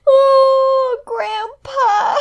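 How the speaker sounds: high, tearful laughing cry; laughing through tears. Real, not acted. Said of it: "so I'm gonna cry".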